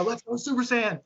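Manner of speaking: high-pitched re-enacted pump-up call; mock-rallying cheer squad cry; imitated hype-up. Re-enacts his pre-routine hype cry.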